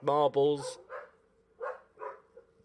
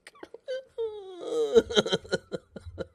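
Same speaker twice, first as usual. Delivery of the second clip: high, breaking laugh of disbelief; exasperated laugh. Real, not acted. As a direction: laugh at how bad it is. After the furious outburst.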